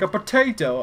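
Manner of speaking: high, loud mock British accent. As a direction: mimic a kid doing a British meme voice. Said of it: "says it like a British accent".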